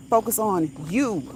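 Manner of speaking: ordinary talk, nothing marked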